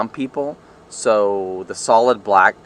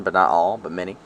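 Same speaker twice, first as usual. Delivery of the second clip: ordinary talk, nothing marked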